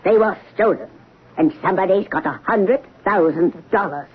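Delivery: low, deliberate reproach; accusing client, pitched low; stern accusation. Acted. Client blames broker for lost bonds.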